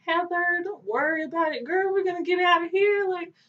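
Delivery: put-on reassuring girly voice. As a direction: soothe your cellmates in a high, bubbly voice. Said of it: "And I'm like".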